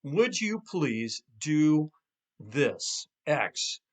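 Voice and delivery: slow, deliberate request phrasing; slow model request. Shown as a demonstration. Coach modeling the request to couples, slow and deliberate. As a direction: spell out the template slowly. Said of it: "Request basically needs to go like this".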